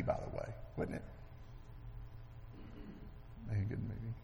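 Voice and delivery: unvoiced, soft throwaway aside; whispered aside. Real, not acted. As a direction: lean in and whisper it as a joke.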